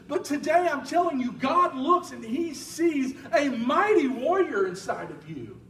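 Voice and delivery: rally the congregation; fiery sermon climax; preaching at full force. Acted.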